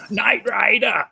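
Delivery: very high, loud put-on voice; hammed-up high-pitched title call; Knight Rider catchphrase. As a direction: squeal out the show title in play. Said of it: "put that back on and say".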